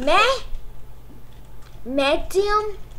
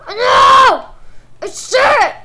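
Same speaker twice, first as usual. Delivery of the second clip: loud, high-pitched outburst; frustrated yell; 6 semitones higher, 9 dB louder, much slower than usual. Real, not acted. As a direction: yell it at the game. Player yells at the game.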